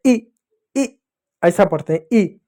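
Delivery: ई said short and crisp, repeated; short i sound. Shown as a demonstration. Teacher showing the short i, crisp ई. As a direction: clip each ई short for pupils. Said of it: "कैसे पढ़ते हैं".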